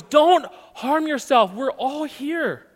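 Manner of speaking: high, slow loud call; loud cry. Shown as a demonstration. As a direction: slowly cry out to reassure. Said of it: "He cried with a loud voice".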